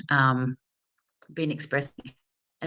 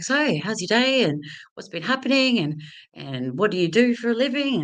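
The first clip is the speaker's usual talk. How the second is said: higher, breezy small talk that covers the nerves; fake casual chat; 6 semitones higher than usual. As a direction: chat him up to sound like a pro. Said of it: "pretend that I am confident and fake it".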